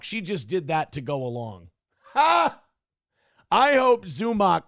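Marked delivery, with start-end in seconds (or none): 2.1-2.6 loud, high mocking bark of laughter; a single explosive, disbelieving "Ha!"; scoffing "Ha!". Real, not acted. Streamer scoffing at her claim, loud derisive "Ha!".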